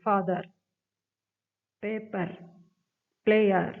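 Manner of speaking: "Father", "paper", "player", each in two distinct beats, pausing between words; two separate sounds. Shown as a demonstration. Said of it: "ആ രണ്ട് sound separate ആയിട്ട് വരും". ("Father", "paper", "player" രണ്ട് ശബ്ദമായി വേർതിരിച്ച്)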